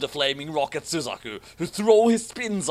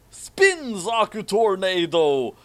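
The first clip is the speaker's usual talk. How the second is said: slow, loud, higher anime move shout; passionate attack call; 5 semitones higher, 4 dB louder, slower than usual. Acted. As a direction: yell the move name with hammed-up passion. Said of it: "Suzaku said passionately".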